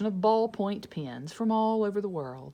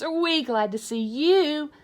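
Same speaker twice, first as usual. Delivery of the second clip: put-on higher, louder happy kids; relieved kids; 8 semitones higher, 7 dB louder than usual. Kids welcoming their teacher, higher and louder.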